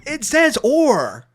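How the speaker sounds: slow, high, loud and emphatic; exasperated insistence. Retold or imitated. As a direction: spell it out, fed up. Exasperated repeat in debate.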